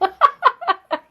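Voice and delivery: high-pitched burst of laughter; real high giggling fit; laughing. Real, not acted. Laughs after being corrected.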